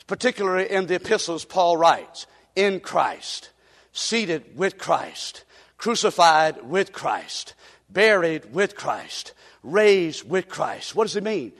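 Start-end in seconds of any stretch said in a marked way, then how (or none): 2.6-11.0 slow, soft, each phrase laid down; slow solemn listing. Acted. Preacher listing union with Christ, slow and soft. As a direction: let every "with Christ" settle slowly.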